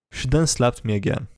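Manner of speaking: ordinary delivery, nothing marked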